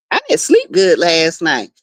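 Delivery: ordinary talk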